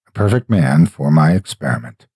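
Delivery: plain reading voice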